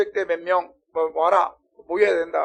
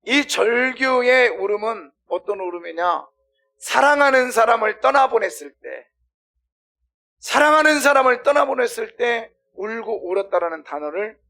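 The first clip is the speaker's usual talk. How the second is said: loud, high, emphatic sermon delivery; impassioned, projected pulpit voice; forceful preaching; 6 semitones higher, 9 dB louder than usual. Real, not acted.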